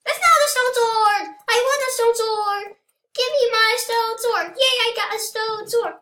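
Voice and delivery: loud, very high excited pleading; high-pitched clamour. Pretend. Player demands the stone sword in game, high-pitched clamour.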